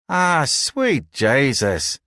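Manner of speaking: slow, drawn-out Irish brogue pitched high; broad Irish brogue. A narrator's reading. A crewman groans at the seasick newcomers, broad Irish brogue. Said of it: "in a broad brogue".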